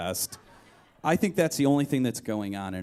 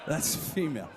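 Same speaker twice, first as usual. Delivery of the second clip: laughing through it. Retold or imitated. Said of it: "I'm like".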